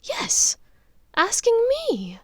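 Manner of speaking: a mother firmly insisting she was asked; vehement. Acted. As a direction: press the point hard. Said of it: "Narcissa said vehemently".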